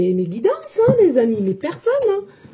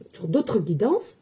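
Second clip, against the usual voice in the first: ordinary talk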